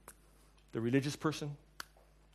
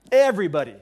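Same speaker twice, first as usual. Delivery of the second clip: loud emphatic everybody; 8 dB louder, faster than usual. Real, not acted. Preacher repeats word louder.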